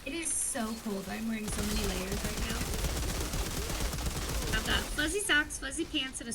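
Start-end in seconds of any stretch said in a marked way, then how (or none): none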